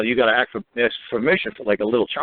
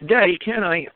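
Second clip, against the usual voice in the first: higher, lightly voiced child's request; pleading kid; 7 semitones higher, slower than usual. Kid coming up to a parent, lightly mimicked pleading. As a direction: lightly play a kid asking a favour. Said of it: "a kid comes up to you and says".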